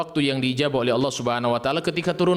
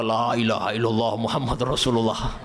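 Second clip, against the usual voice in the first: lightly voiced stern father's outburst; understated angry exclamation; 3 semitones lower than usual.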